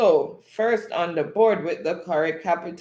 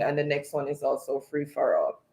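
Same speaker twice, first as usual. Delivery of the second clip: ordinary talk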